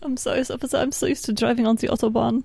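put-on lightly done Russian accent; a hint of a Russian accent. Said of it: "That was my Alexandra Russian accent".

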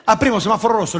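ordinary talk

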